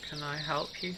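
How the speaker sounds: small, quiet, withdrawn voice; very soft figure. Acted. Stranger to the approaching Sir Alan, very softly. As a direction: ask it softly, barely reaching the listener. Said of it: "that's literally like, how she says it".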